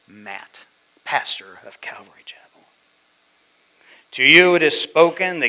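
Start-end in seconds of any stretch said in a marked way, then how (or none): 0.0-2.4 whispered address. Retold or imitated. God's voice addressing pastor.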